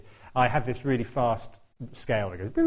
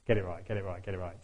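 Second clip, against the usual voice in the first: self-talk; 4 semitones lower, 7 dB quieter than usual. Retold or imitated. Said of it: "so I'm like".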